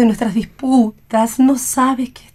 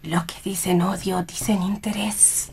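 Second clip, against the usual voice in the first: softer, sly whispered aside; 9 dB quieter than usual. Acted. Mother whispers a cynical aside about love. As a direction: drop to a knowing whisper.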